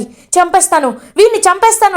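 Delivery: a hammed-up outburst at his son; mock shouting. Father threatening his son in rage, mock shout. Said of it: "గట్టి గట్టిగా అరుస్తూ".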